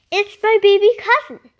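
a fast, loud nervous fib; quick fib. A narrator's reading. Boy lying to the neighbour about his pig, fast loud fib. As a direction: blurt the lie out fast. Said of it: "he lied".

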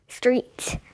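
plain reading voice

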